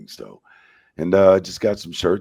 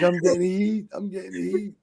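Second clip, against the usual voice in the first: anxious complaint; 12 semitones higher than usual. Retold or imitated. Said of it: "I'm like".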